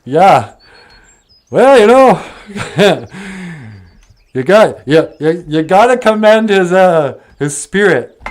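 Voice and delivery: loud, high-pitched ribbing through chuckles; jeering friends chuckling on the surface; amused mockery. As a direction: laugh while giving backhanded praise. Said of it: "they're still laughing".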